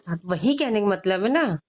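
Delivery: loud retort; real heated rejoinder; arguing back. Real, not acted. Argues back in a real quarrel.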